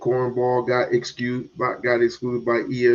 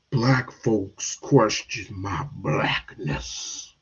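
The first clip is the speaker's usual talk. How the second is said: mocking RG3; 5 semitones lower, much slower than usual. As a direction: drawl it like a sulky complaint. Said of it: "he got the nerve to tell Stephen A".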